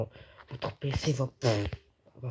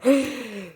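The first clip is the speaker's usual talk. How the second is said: sharp, audible intake of surprise; excited gasp; 7 semitones higher, 4 dB louder than usual. Real, not acted. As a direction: gasp at a sudden discovery. Recognises another player. (hoquet de surprise)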